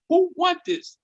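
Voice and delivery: high, cocky challenge; challenging. Retold or imitated. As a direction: dare anyone to step up. Challenging again.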